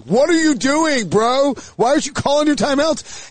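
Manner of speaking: high-pitched, drawn-out mock protest; exasperated disbelief. Fan retelling his reaction to Shanahan's timeouts, exasperated. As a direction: plead with the coach in incredulous retelling.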